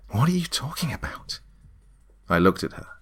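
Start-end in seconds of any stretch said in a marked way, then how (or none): 0.1-1.4 high, alarmed question. A narrator's reading. Karen questions the demon's claim, alarmed. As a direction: blurt it high in bewildered fear.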